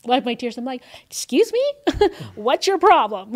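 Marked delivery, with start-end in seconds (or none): 1.1-3.3 loud, high, confrontational "Excuse me?"; ready for a fight, loud and high; battle mode. Retold or imitated. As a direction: square up and call them out. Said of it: "in my ready battle mode".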